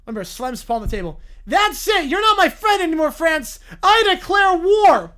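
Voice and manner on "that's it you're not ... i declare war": higher, louder screaming tantrum; furious tantrum. Acted. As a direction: slam the table and yell. The bear slams the table.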